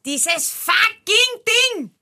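langsam, hoch, voller Inbrunst; fucking genüsslich auskosten; mit Inbrunst. Retold or imitated. (mit Inbrunst fluchen)

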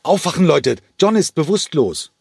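frantic roar at sleepy passengers; panicked yell. Acted. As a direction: bellow it, scared for John. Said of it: "Diesmal brüllte er. Panik lag in seiner Stimme".

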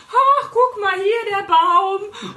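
high, slow, dreamy gawking; drawn-out, oblivious marveling at a tree; dawdling pedestrian. Retold or imitated. Mocking slow dawdlers on pavement. (verträumter Spaziergänger, hoch und langsam)

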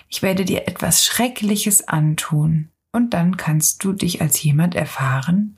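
plain reading voice

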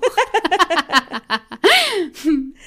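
long laugh. Real, not acted.

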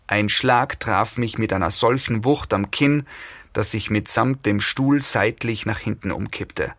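usual speaking voice

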